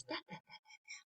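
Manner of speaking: put-on whispered baby babble, no voice; baby babbling. Baby babbling back to his mother, whispered. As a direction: breathe the syllables like a tiny baby. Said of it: "And he says".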